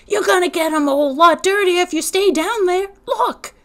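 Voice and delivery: high, loud urgent shout; urgent warning. Acted. Stacy yelling at little man as the bus comes, urgent. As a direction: yell to make him see the danger.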